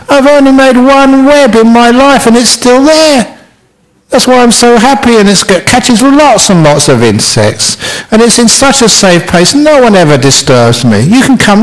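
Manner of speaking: fat happy spider. Acted. Fat spider explains his safe web, high and happy. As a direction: boast happily from up high. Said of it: "said the fat happy spider".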